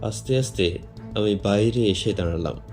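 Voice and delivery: ordinary delivery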